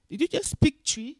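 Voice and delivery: voices the boss, high and loud, amazed; surprised question. Retold or imitated. Boss surprised at her Twi.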